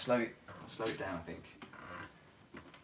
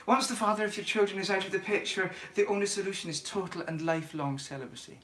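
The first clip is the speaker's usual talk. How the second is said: higher-pitched, measured Scottish lilt; movie quote in a high Scottish voice; Mrs Doubtfire's Scottish accent; 5 semitones higher, 3 dB quieter than usual. Acted. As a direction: recite it in a put-on Scottish accent. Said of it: "my Scottish accent is improving then".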